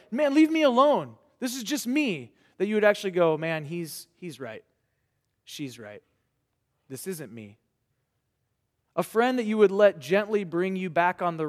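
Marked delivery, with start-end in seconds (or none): 0.1-2.3 defensive brush-off. Retold or imitated. 3.4-7.6 much softer, humbled admission; hushed, conceding you were wrong; quiet realization. Retold or imitated. Young person admitting a friend was right, hushed and humbled.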